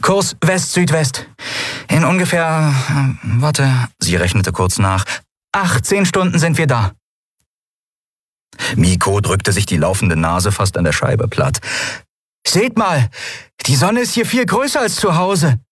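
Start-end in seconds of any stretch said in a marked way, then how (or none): 0.0-6.9 put-on higher, quick young captain pressing on; brisk captain. A narrator's reading. Endriel voiced in story. 12.5-15.6 put-on higher, excited young voice at the window; excited kid. A narrator's reading. Miko voiced in story.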